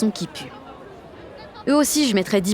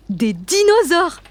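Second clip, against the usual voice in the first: much higher, slow, astonished; amazed; 9 semitones higher, 4 dB louder, much slower than usual. Acted. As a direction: marvel at the apron. Amazed at dinosaur apron. (stupéfaite, aiguë et traînante)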